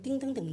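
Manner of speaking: high-pitched tinkling "ting" noises; light, high dinging sound; imitated chime.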